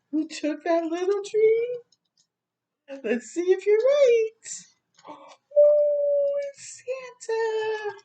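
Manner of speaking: high, building excitement toward the big reveal; excited reveal. Acted. Revealing Santa to a child.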